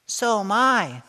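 put-on high, small little girl's voice. Teacher voicing Rachel insisting she'll go too, high little girl voice.

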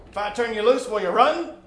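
stern challenge; stern woman. Acted. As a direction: test the boy sternly.

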